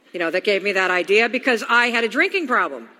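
ordinary talk, nothing marked

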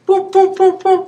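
high, bouncy "boop" noise; mock boop sound. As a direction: pop it out like a cartoon bump. Devil knocks you off fence.

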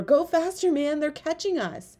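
high-pitched panicky urging; urgent alarm. A narrator's reading. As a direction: hurry him on as pursuers close in. Urging his friend while being chased.

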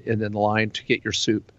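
ordinary talk, nothing marked

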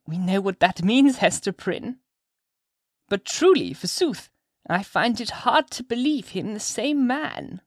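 put-on high, slow, swooping old woman's voice; old lady insinuating, pitched high and drawn out; sly old witch-lady. Mistress Hibbins goes on insinuating.

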